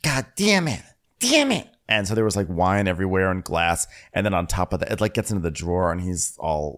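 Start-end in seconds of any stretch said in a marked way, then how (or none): 0.0-1.7 high-pitched mock cursing; Luke. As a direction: imitate someone cursing a mishap. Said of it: "being Luke about it".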